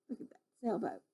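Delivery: ordinary delivery, nothing marked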